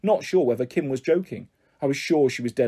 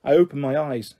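ordinary delivery, nothing marked

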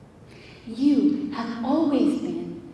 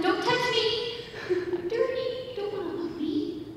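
ashamed son; 10 semitones higher, 8 dB quieter than usual. Acted. Ashamed son pushing father away.

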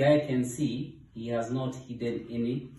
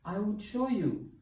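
high, eager hippo voice; earnest high character voice; promising hippo; 10 semitones higher than usual. A narrator's reading. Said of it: "promised Hippo".

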